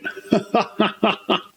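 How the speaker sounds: quiet high chuckle; laugh. Real, not acted. Laughing at the Mister Whipple joke, quiet chuckle. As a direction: let a soft laugh out.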